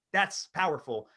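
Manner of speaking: ordinary talk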